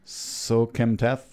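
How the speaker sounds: drawn-out, syllable by syllable Sokemtath; feeling out a hard name; slow careful name. Shown as a demonstration. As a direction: sound out the unfamiliar surname slowly. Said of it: "I gotta say it slow first".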